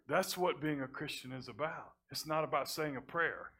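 usual speaking voice

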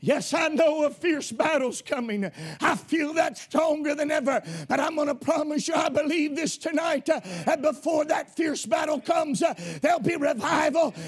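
full-throated revival preaching, rising to a shout; preaching at full force. Real, not acted. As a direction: preach it hard and build the fire.

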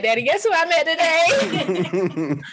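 high, slow, giggling excitement; excited. Retold or imitated. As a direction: gush to your dad, laughing. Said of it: "I call my daddy like".

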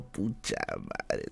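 low, slow, sinking groan of dismay. Retold or imitated. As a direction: let it sink out slowly. Dismayed reaction.

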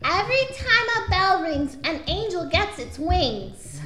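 put-on high, eerie little girl voice; creepy child. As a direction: deliver it like an unsettling child. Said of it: "Really good at creepy kid voices".

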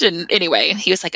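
normal talking voice

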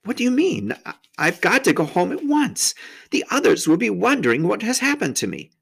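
put-on anxious protest as young Lucy; rather frightened. A narrator's reading. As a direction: plead with mild alarm. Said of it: "feeling rather frightened".

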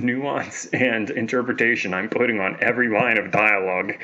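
ordinary delivery

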